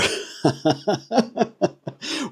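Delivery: high chuckling laugh; amused laugh after one word. Real, not acted. Beer reviewer cracks up after saying lager, laughing.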